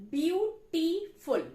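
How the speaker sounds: beautiful said slowly, syllable by syllable; slow syllable demo. Shown as a demonstration. Teacher showing students the syllables, slow and clear. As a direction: spell out beautiful in three beats. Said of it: "of three syllable".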